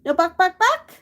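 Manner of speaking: a final rise squeaking way up; high pet talk. Real, not acted. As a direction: coax the bird with a climbing squeak. Coaxing parrot.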